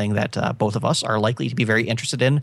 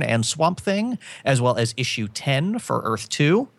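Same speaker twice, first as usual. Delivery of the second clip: upbeat, higher release-list announcer; announcer read; 4 semitones higher than usual. Acted. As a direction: read the list like a radio host.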